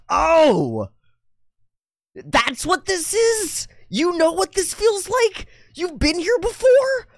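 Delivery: put-on very high, squeaky kid voice; astonished kid. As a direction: squeal a child's drawn-out amazed questions. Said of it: "And the kid goes".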